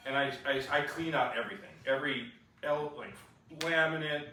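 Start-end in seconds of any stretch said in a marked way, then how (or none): none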